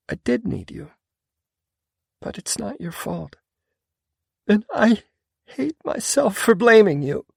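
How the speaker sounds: high female voice, rising to anguish; tearful woman. A narrator's reading. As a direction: break into despair at the end. Female character near tears.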